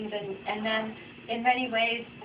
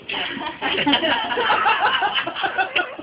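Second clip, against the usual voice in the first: long, much higher peal of laughter; 11 semitones higher, 7 dB louder than usual. Real, not acted. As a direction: laugh hard at the perfect line. Laughing at the fortune line.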